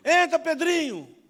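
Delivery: loud, high, drawn-out call. Retold or imitated. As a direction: boom a welcome to a child, stretching the words. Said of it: "o pai dele falou assim".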